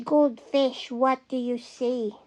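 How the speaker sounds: plain reading voice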